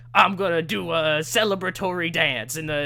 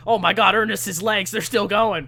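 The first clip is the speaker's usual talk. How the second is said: mock commentator excitement; 4 semitones higher, 6 dB louder than usual.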